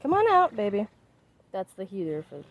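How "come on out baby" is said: coaxing call. Real, not acted. Calling to pet outside.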